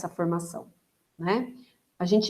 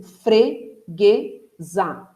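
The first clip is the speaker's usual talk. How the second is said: freguesa very slow, split fre, gue, za; syllable by syllable; much slower than usual. Shown as a demonstration. Teacher counting mouth openings, freguesa said in three slow syllables. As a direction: break freguesa into three separate beats. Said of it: "Eu abri três vezes a minha boca".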